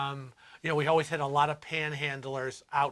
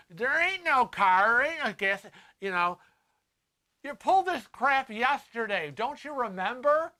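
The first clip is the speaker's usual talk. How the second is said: mock scolding; 7 semitones higher than usual. Telling off repeat panhandler.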